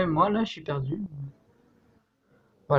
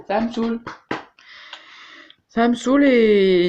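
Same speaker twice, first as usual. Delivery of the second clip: raging gamer; 6 semitones higher than usual. Real, not acted. Annoyed gamer complaining.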